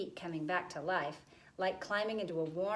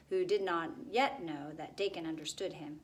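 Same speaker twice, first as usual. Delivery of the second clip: ordinary delivery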